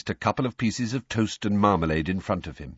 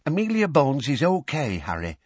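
higher-pitched, earnest reassurance; mean it sincerely, pitched up at the start; lightly voiced earnest character; 8 semitones higher than usual. A narrator's reading. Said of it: "said Tonks earnestly".

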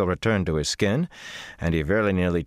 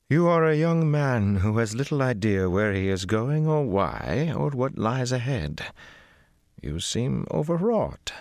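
lightly voiced older woman, patient; weary Cadsuane; 7 semitones higher than usual. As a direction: speak like to a tiresome boy. Cadsuane speaking after a sigh.

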